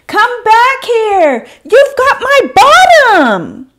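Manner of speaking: very high, loud shout. Acted. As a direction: shout after them. Shouting after the rabbits.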